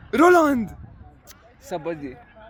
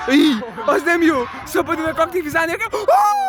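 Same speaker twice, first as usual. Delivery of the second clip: high, fast panicked yells; rising to a shriek; alarmed shouting; 6 semitones higher than usual. Real, not acted. Danger in front of goal.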